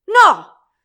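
high, very loud roar of refusal; explosive enraged shout; furious scream. A narrator's reading. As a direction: shriek it in rage. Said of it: "lanciò un urlo infuriato".

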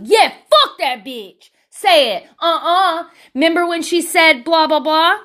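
loud, high hype-man cheering. Acted. Hyping up a friend in a confrontation, rowdy hype man. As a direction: egg a friend on noisily. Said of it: "just be your hype man".

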